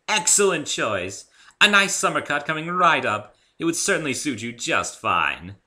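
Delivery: a gracious unicorn hairdresser; refined Rarity. A narrator's reading. Rarity offering Dave a haircut, high and refined.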